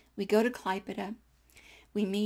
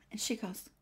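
normal talking voice

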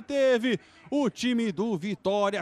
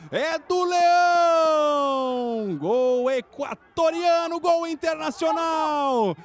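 goal shout; 6 semitones higher, 3 dB louder, slower than usual. Real, not acted. Goal celebration.

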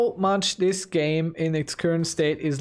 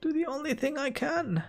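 high, slow, drawn-out mock grandeur; pompous ruler voice; 9 semitones higher, slower than usual. Gamer hams up a ruler declaring war on Sardinia. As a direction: declare it like an offended emperor. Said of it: "I don't know why I'm talking like this".

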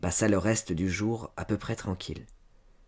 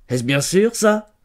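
loud, rising, doubting question; suspicious challenge; 10 semitones higher, 10 dB louder, slower than usual. A narrator's reading. Sly farmer sniffing out the truth, suspicious. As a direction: press her, not believing a word.

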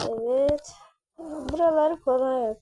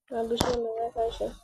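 ordinary talk

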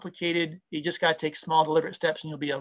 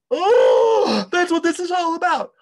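eureka shout; 11 semitones higher, 8 dB louder, slower than usual. Pretend. Mock eureka about if-then-else.

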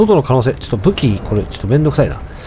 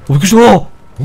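startled; 5 semitones higher, 4 dB louder than usual. Real, not acted.

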